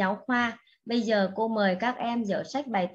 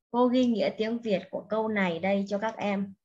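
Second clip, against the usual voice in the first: normal talking voice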